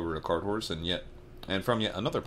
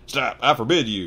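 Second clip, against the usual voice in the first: panicked yell; 5 semitones higher, 9 dB louder than usual. A narrator's reading. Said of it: "yelled Uncle Vernon in a panic".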